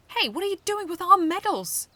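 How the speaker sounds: high piercing shriek. Acted. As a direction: shriek it out in outrage. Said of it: "Shrimplip shrieked".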